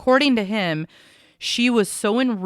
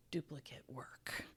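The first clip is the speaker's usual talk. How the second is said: whispered flub; 4 semitones lower, 25 dB quieter than usual. Real, not acted. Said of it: "Okay, sorry".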